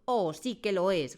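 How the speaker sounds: loud drawn-out retort; shouted. Acted. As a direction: bellow the accusation. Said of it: "gritó la señorita Trunchbull".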